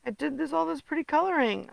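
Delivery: normal talking voice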